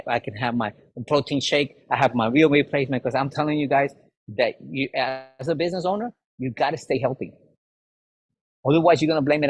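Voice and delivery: normal talking voice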